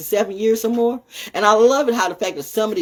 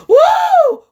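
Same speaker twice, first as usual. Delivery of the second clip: sudden high gasp then a loud whoop; excited whoop; 7 dB louder than usual. Real, not acted. Cheering to the chat, a loud gasping whoop. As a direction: let out a thrilled cry.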